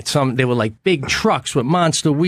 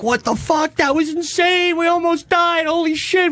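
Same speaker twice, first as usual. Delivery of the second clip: amazed relief. Retold or imitated. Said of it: "you're like".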